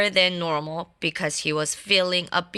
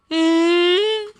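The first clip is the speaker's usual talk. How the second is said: disapproving mm; 8 semitones higher, 4 dB louder than usual. Acted. Reaction to no cornflakes.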